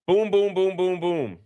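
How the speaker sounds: booming sound-effect voice; imitated explosion noises; mock explosion booms. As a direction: sell the blast with your mouth.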